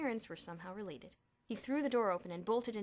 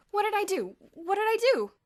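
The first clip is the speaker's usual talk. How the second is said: put-on anxious boy voice; 13 semitones higher, 16 dB louder than usual. Perry anxious.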